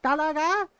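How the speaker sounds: high, loud disbelieving "Talaga?"; mock a mother's outraged disbelief; mom in disbelief. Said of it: "My mom got so mad".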